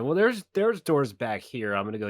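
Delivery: loud, high call easing off at the end; hollering. Acted. Player's character tells the party about other doors, hollering. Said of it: "I holler out".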